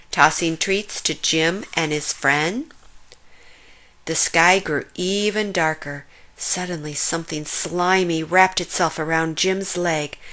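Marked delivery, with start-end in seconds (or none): none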